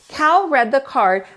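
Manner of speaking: ordinary delivery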